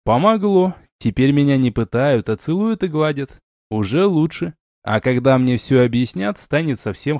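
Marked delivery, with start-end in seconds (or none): none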